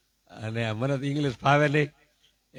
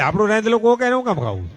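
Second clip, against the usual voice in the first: indignant outburst; 7 semitones higher, 9 dB louder than usual. Retold or imitated. Indignant retort in joke.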